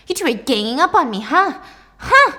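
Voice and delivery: fast, rising challenge to a loud high huh; paranoid accusation. Acted. Mom accuses doctor and daughter of ganging up, paranoid. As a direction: escalate to a piercing demand.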